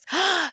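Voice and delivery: sharp high gasp of delight. Real, not acted. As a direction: catch your breath in joy. Reacting to viewer's sentence.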